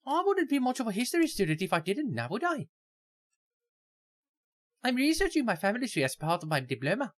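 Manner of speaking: put-on Irish accent. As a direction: give her a high Irish lilt. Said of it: "Irish accent".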